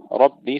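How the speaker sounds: ربي with a full, heavy emphatic R. Shown as a demonstration. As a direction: say ربي with the R thick and velarized. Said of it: "تفخيم الراء". (راء مفخمة في ربي)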